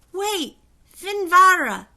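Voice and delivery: high, loud, slow queenly command. A narrator's reading. High Queen stopping the king, loud and ringing. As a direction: halt the king with authority. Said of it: "said the High Queen".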